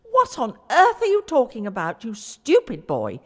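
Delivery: father roaring at his lying son; shouting in a rage. A narrator's reading. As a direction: blast the boy with fury. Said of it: "he shouted in a rage".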